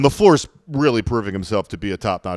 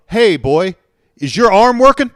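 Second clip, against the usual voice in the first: mock Wisconsin accent; 8 semitones higher, 9 dB louder than usual. Podcaster imitating a Green Bay coach, hammed-up Wisconsin accent. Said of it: "went back and forth with Wisconsin accents".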